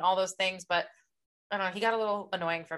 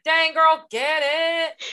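high, loud whoop of approval; hyped cheer; 9 semitones higher, 8 dB louder, much slower than usual. Retold or imitated. Cheering on the book's heroine.

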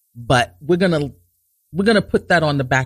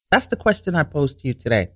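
ordinary talk, nothing marked

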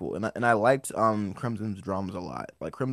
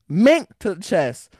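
loud; 9 semitones higher, 7 dB louder than usual. Retold or imitated. Retelling a street fight.

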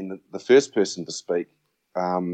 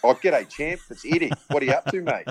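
chirpy, higher breezy phone hello; Eddie on phone; 6 semitones higher than usual. Retold or imitated. Coach ringing the struggling player years later, breezy and cheeky. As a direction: play the old rival calling out of the blue, all friendly. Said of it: "a voice comes on and goes".

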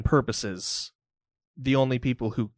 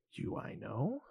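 much softer, knowing villain; quiet villain line; 13 dB quieter than usual. Acted. Robed man recognising Mick, much softer.

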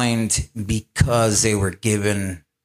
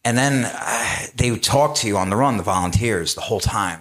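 usual speaking voice

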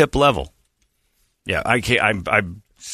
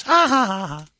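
fake laughter; 11 semitones higher than usual.

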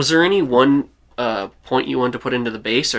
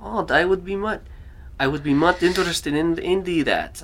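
put-on higher-pitched Russian accent; [name]'s Russian accent; 6 semitones higher than usual. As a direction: show keen interest in a Russian accent. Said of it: "I mean, a Russian accent".

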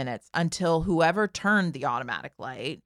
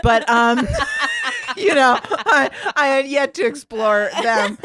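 laughing through the words, higher and louder; 7 semitones higher, 9 dB louder than usual. Real, not acted. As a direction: giggle while you say it. Laughing while retelling embarrassment.

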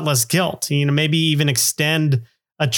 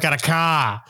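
Boston-style dropped R in car; lightly hammed-up Massachusetts accent; bad Massachusetts accent; slower than usual. Host jokes about resort giveaways, in a bad Massachusetts accent. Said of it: "Massachusetts accent".

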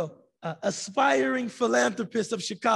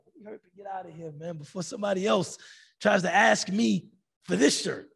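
hurrying out; 3 semitones lower, faster than usual. Pretend. Imagined self rushing out of market.

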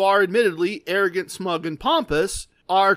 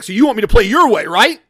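louder, fast mock-angry challenge; really mad; 3 semitones higher, 8 dB louder, faster than usual. Angry gambler confronting the amused winner, mock mad. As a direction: imitate an angry player firing back. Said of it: "now he's really mad".

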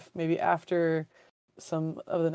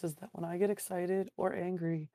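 normal talking voice

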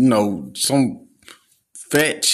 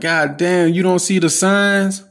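higher, exasperated disbelief; upset at people ignoring the signs, higher and exasperated; 8 semitones higher than usual. Retold or imitated. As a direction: throw up your hands, voice pitched up. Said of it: "I just get upset sometimes".

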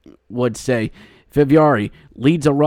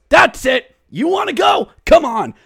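high shouted challenge; goalie picking a fight; 14 semitones higher, 3 dB louder than usual. Retold or imitated. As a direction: yell it like a goalie ready to fight. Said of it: "and goes".

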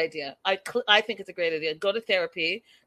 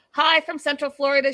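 cheerful greeting; 7 semitones higher, 5 dB louder than usual. Retold or imitated. Reading viewer greeting.

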